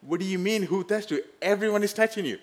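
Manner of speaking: lightly voiced Peter, half laughing at the absurd question; incredulous Peter. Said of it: "Peter said".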